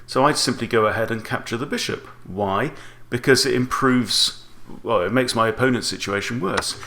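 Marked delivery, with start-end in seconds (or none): none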